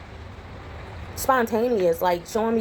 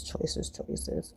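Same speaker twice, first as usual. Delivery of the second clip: breathy musing under the breath; whispered choices; 12 dB quieter than usual. Shown as a demonstration. Musing to herself over the toaster spot, whispering. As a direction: whisper it to yourself, softer.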